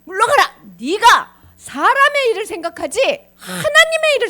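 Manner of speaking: loud, high, emphatic rebuke; acted thundering reprimand; stern scolding. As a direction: tell him off forcefully, word by word. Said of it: "사탄이라고 혼냈어".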